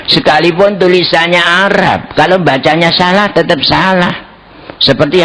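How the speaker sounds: high, insistent arguing; emphatic debate rebuttal. Acted. Debater rebuts the kiai over misreading versus miswriting, high and insistent.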